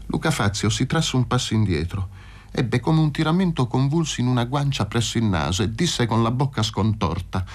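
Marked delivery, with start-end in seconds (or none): none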